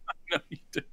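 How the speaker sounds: breathy whispered tease, laughing. Real, not acted. Teasing co-host about loving fire, laughing whisper.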